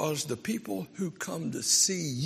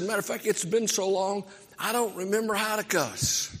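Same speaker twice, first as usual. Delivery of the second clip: exasperated pastor; 8 semitones higher, 4 dB louder than usual. Retold or imitated. Pastor confesses to the kid.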